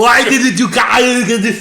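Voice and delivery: put-on slow, loud hammy Scottish accent; Scottish accent retry. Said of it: "I said".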